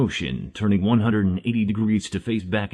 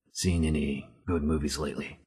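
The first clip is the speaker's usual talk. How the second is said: softer, lower deadpan delivery; deadpan quiet question; 4 semitones lower, 7 dB quieter than usual. A narrator's reading. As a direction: drop the voice, dry and flat.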